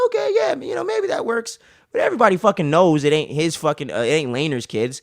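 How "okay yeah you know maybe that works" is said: much higher mock voice, half-convinced; unconvinced courtiers. Courtiers shrugging off the kids' looks, high mock voice. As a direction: pitch it way up, going along reluctantly. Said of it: "They're like".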